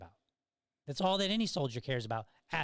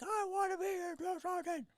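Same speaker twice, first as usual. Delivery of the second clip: much higher squeaky strained reply; squeaky frightened recruit; 4 dB quieter than usual. Retold or imitated.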